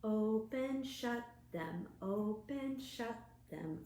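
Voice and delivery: ordinary delivery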